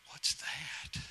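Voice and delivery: whispered, baffled question; Adam quietly clueless about woman; puzzled whisper. Acted. Adam whispering his confusion to God.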